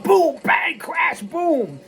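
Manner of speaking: high, punchy crash-bang words; comic fight noises. Acted. Piper turning it on against Frankie, punchy fight noises. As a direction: punch out each blow high in your voice.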